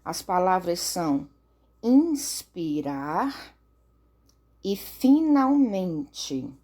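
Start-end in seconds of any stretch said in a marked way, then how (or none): 1.8-3.5 "inspirar" stretched out slowly syllable by syllable, rising at the end; syllable-timed demo. Shown as a demonstration. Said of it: "Marque um X na sílaba tônica". 4.6-6.5 "finalmente" drawn out very slowly, weight on "men", falling; syllable-timed demo. Shown as a demonstration. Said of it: "Marque um X na sílaba tônica".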